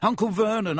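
plain reading voice

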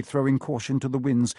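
plain reading voice

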